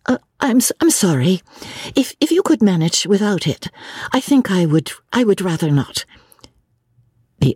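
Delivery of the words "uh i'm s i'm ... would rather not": higher-pitched, halting polite refusal; hesitant apology. Acted. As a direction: stammer an apology and gently decline. Lucy haltingly declines to tell.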